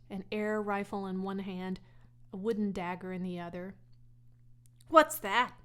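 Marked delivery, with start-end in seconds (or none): 4.9-5.5 louder, higher demand; boy demanding to know what Fern has. A narrator's reading. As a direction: bark the question. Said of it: "he demanded".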